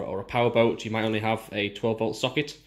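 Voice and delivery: usual speaking voice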